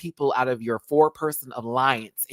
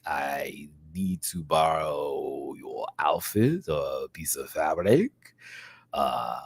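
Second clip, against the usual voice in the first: much lower, slow deep voice; 8 semitones lower, much slower than usual. Retold or imitated. Imitating Le Grand Dame to a fan, low and slow. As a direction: drop way down and drag it out slowly. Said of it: "I am a sucker for a deep voice".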